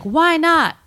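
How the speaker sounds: high-pitched, loud calling-out; movie hero's question. Retold or imitated. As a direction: shout the question across a crowd. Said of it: "And he's like".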